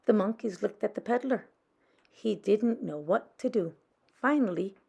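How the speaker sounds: usual speaking voice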